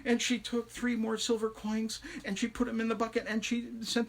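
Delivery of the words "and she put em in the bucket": ordinary delivery, nothing marked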